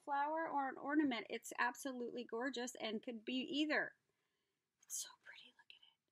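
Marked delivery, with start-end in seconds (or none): 4.8-6.0 hushed admiring whisper; soft whisper. Real, not acted. Admiring a flower ornament, soft whisper. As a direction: breathe it softly.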